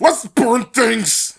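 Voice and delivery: slow, lightly voiced slacker teen; Joseph Gribble impression. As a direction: drawl it like a dopey teen. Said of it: "That's how that's what I sound".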